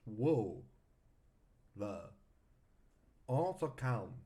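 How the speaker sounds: halting "Whoa. La. On se calme", long gaps between; learner's stiff attempt at "Whoa. La. On se calme"; Google Translate French. Real, not acted. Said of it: "it sounds like I'm, uh, listening to Google Translate".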